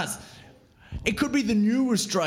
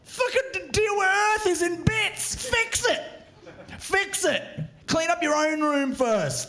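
exasperated rant; 6 semitones higher than usual. Acted. Ranting at space billionaires.

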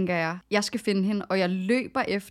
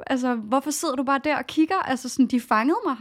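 higher, urgent mock outrage; retold angry confrontation; 5 semitones higher, faster than usual. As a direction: replay the shocked accusation at the friend. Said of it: "jeg kigger på hende og siger".

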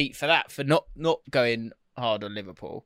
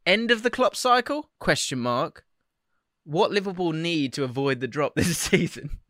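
reading a comment; 4 semitones higher than usual. Real, not acted. Reads chat title.

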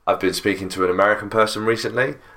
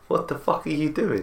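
outraged; 6 semitones higher, 5 dB quieter than usual. Retold or imitated. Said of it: "'cause they're like".

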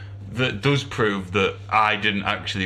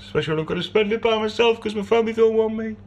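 mock self-pity; 10 semitones higher than usual.

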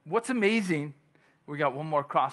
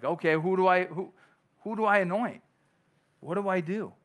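higher, louder puzzled questions; acted bewilderment; 5 semitones higher, 4 dB louder than usual. Preacher voices Samuel unsure whom to anoint, bewildered. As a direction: play at being at a loss.

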